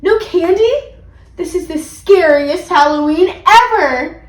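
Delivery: high, loud, theatrical disbelief; slow, dramatic lament; dismayed outcry. A narrator's reading. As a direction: wail it like a cheated child. Child dismayed at bad news.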